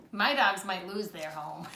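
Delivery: usual speaking voice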